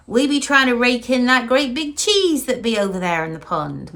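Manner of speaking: a broad Wiltshire burr put on for the joke; Wiltshire accent. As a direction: play the Wiltshire country bumpkin, a bit hammed up. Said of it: "in his very thick Wiltshire accent".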